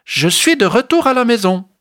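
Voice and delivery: high happy calling out; joyful call. Acted. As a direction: call out with delight. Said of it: "La petite puce appelle joyeusement".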